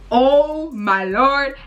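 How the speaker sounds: drawn-out, mildly imitated dread; a slow, stretched echo of panic from the dream; retold terrified cry. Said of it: "And I'm crying and I'm screaming".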